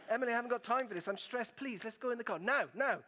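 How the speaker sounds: an exasperated father running late; mock stressed plea; stressed dad.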